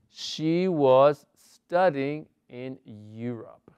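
slow, even English example; slow model reading. Shown as a demonstration. Teacher repeating the past continuous example slowly. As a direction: read it again slowly and clearly. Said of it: "teacher repeats model".

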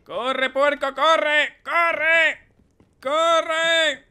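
high, loud repeated yells; shouting. Real, not acted. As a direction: scream at it to run. Yelling at pig to run.

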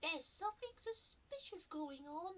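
very high, slow strained put-on voice; fake voice. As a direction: squeeze it out in a high disguise. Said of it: "put on the fake voice".

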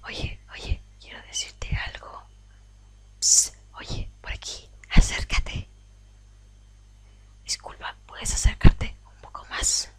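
secretive whisper. Acted. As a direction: lure them closer in a whisper. Personaje susurra para atraer al jugador. (susurro misterioso)

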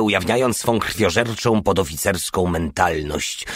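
ordinary delivery

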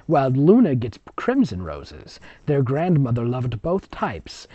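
ordinary delivery, nothing marked

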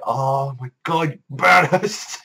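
slow high awestruck fangirl squeal; starstruck gushing. Retold or imitated. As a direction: stretch it out like a swooning fan. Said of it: "I'm like".

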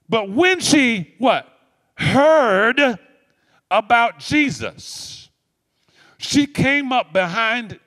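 high, loud, slow climactic preaching; rising preaching shout. Real, not acted. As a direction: lift the congregation on "Heard". Preacher call and response climax.

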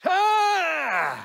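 loud high villain cry; evil shout. Acted. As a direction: scream it out in rage. Villain cries out.

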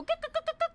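high choked guk sounds; mock gulping noise. Streamer mocking a game character's pickup noise, high guk sounds. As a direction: mimic a silly pickup sound. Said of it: "need me to pick something up and go".

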